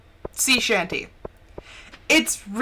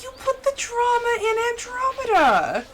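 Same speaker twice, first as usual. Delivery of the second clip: much higher, sing-song teasing line; playful teasing; 13 semitones higher than usual. Retold or imitated. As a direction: tease her like a sassy mom. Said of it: "I was like".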